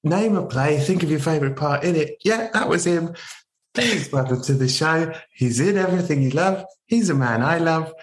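grand announcer build to a high finish; showman introduction. Acted. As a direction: hype up the guest like a ringmaster. Hammed-up grand guest introduction.

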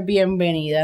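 normal talking voice

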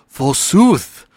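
put-on old-timey peasant voice; medieval peasant. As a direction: hawk mead like a medieval peasant. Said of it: "says something like".

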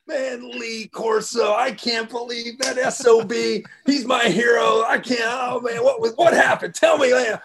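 high excited shouting; fired-up shout. Retold or imitated. Phil Knight greeting the crew, high excited shout. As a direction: yell it like a thrilled fan. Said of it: "and he's like".

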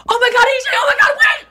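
high screaming panic from the car; panicked shout. Retold or imitated. Parents on speakerphone, panicked high shout. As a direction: shriek it high like a crash is coming. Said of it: "And I just hear".

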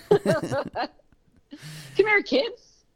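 high, lilting creepy lure; creepy stranger voice. Pretend. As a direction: call the kids over like a creepy stranger. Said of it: "No, I'm just kidding".

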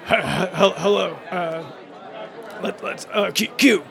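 halting attempt at a Q accent; hesitant, hammed-up Q accent; made-up Q accent. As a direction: fumble into an invented Q accent, nervous chuckle first. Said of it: "The Q accent".

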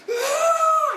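put-on long, very high, loud child's scream "Ah"; imitated almighty scream. Pastor imitating his daughter's scream after hitting her head, very high. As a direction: let out the delayed hurt scream of a child. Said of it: "this almighty scream".

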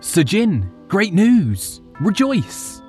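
high, excited servant bursting in; delighted exclamation. Acted. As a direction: blurt out the good news, thrilled. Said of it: "exclaimed in great delight".

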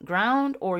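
ordinary delivery

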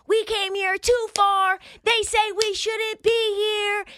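high, slow, taunting fan voice; hyped Bengals fan. Retold or imitated. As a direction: crow at the doubters in a high put-on voice. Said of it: "they start telling everybody what's what".

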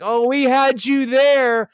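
high, slow imitated gloating; mock roaring hilarity. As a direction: drag it out high, imitating a hearty tease. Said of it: "They're roaring with laughter".

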